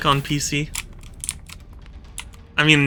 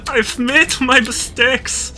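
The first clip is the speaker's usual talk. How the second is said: high, slow hammed-up lament; a high, over-the-top mock lament; mock despair; 8 semitones higher, 4 dB quieter, slower than usual. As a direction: wail it like a tragic hero. Melodramatic despair after losing.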